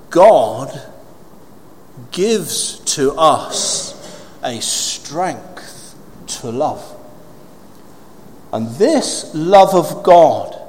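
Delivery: slow, deliberate preacher's declaration; weighty preaching. Real, not acted. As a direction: stretch the key words and pause between phrases.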